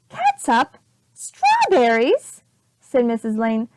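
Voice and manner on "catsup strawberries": much higher, louder and slowed, stretched out; aghast at what was fed; shocked disbelief. Acted. Missus Lane hearing what her baby ate, aghast.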